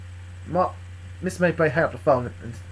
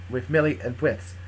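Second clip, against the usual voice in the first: ordinary delivery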